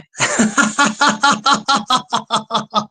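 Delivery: long rhythmic cackle; sustained chuckling, pulse after pulse; real laugh. Real, not acted. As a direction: let out a run of quick, even laughs. Laughs after Scottish blessing.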